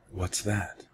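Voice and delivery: put-on a small child asking quietly; little girl's voice. A narrator's reading. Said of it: "The little girl's voice for sure".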